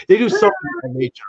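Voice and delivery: high, fast mock purring sound; imitated cat purr. Shown as a demonstration. Imitating a cat's purr for his co-host. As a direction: do your best cat purr, badly. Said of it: "a terrible purr".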